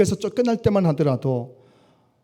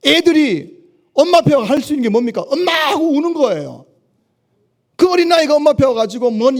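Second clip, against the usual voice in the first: much higher, louder impassioned preaching; preaching at full force; 11 semitones higher, 6 dB louder than usual. Acted. Pastor urging listeners to cry like children, preaching at full force. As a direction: cry it out like a pleading child's plea.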